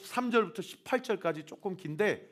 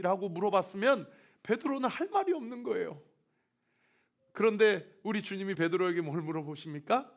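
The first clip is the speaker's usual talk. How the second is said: ordinary talk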